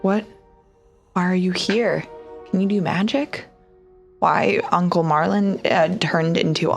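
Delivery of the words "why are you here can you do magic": normal talking voice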